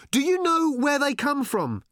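high-pitched calling shout. A narrator's reading. Arthur to old man below the beast, shouting down. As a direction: call it out high over the noise. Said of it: "Arthur shouted down at Thrashbarg".

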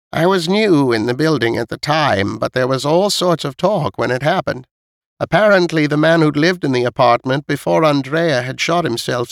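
put-on high-pitched elderly storyteller; elderly neighbour listing past deaths, pitched high; old woman recounting. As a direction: pass on old building gossip in a high old-lady voice. Voices old Missus Knight.

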